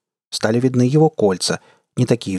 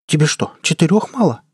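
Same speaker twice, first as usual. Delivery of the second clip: higher, incredulous question; indignant; 7 semitones higher than usual. A narrator's reading. Gunner questions crewmate wanting more arms, indignant. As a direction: challenge her in indignation. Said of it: "возмутилась Джая".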